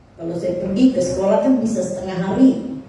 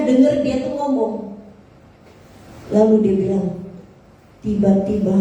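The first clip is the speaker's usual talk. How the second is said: ordinary delivery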